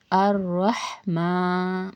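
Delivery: slow, drawn-out Quranic recitation. Shown as a demonstration. Teacher recites the verse for students, slow and drawn out. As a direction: chant it slowly, stretching the vowels.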